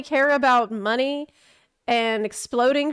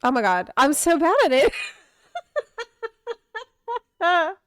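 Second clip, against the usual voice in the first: usual speaking voice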